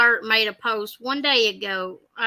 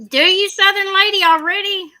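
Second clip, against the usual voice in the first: excited teasing; 8 semitones higher, 6 dB louder than usual. Real, not acted. Teasing friend over sentimental remark.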